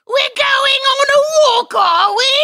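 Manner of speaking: very high, loud British whine; badly faked squeaky British character voice; whiny British accent. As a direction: whine it in a silly, over-the-top British falsetto. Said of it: "the whiny guy from freaking Harry Potter".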